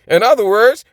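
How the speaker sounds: normal talking voice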